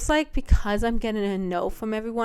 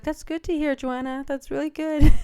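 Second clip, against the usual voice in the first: higher, louder imitation of mom praising; mom's voice; 6 semitones higher, 4 dB louder than usual. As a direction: play mom pleased with her daughter. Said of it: "and she's like".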